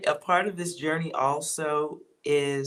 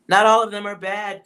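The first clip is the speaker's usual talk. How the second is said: loud; 6 semitones higher, 8 dB louder than usual. Real, not acted. Laughing outburst about addictions.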